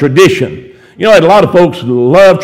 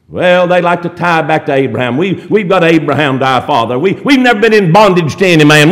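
ordinary delivery

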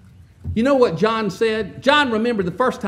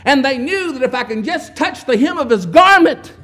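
building preaching; 3 semitones higher, 6 dB louder than usual. Real, not acted. Preacher stirring the congregation about Jesus' healings, rising fervor. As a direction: build the crowd up with each line.

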